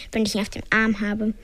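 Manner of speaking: ordinary delivery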